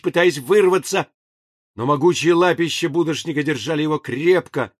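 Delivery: usual speaking voice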